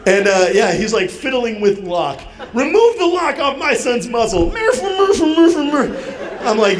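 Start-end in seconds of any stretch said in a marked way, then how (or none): 2.5-4.5 high-pitched mock outrage; hammed-up parental indignation; indignant demand. Dad demanding his son be freed. 4.5-6.4 very high mock wailing through a muzzle; comic stifled squeals; muffled cries. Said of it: "He's muffling his own cries".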